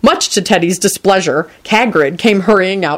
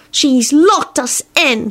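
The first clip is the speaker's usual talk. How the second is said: put-on slow, higher child voice; gloomy; 8 semitones higher, much slower than usual. A narrator's reading. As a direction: announce it slowly and gloomily. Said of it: "he pronounced gloomily".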